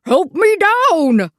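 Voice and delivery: loud, high, slow call; calling for help. Acted. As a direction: drag it out calling down from the tree. Stuck in a tree calling.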